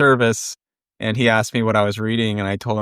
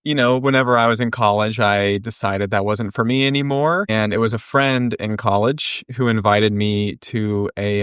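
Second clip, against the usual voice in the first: ordinary talk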